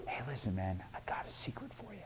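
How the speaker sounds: very soft secretive whisper; hushed, confiding whisper. Shown as a demonstration. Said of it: "whispers, they make us eager".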